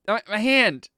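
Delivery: high, shouted directions; really loud. Acted. Deafened woman guiding blinded companion to her hand, shouted. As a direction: holler it as if you can't hear yourself. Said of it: "really loudly says".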